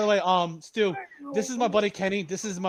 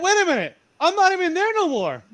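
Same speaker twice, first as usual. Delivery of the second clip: retold in a much higher, louder, startled voice; startled protest; 12 semitones higher, 4 dB louder than usual. To the baby mama at night, a high startled retold protest. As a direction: pitch jumps up in mock alarm.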